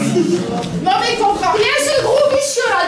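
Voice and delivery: loud threatening yell; angry shout. Acted. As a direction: drive him out at gunpoint. Chasing client at gunpoint.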